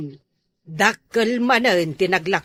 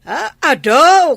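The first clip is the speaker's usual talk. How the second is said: scolding mother; 5 semitones higher, 9 dB louder than usual. Acted. Mother scolding son's laziness.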